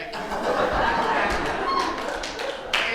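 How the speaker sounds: airy chuckle. Real, not acted. Announcer laughs at his own Sass E. pun, airy.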